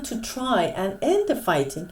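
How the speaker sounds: slow, careful teacher reading; slow clear reading. Shown as a demonstration. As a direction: spell the sentence out slowly for learners. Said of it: "teacher reads the text aloud".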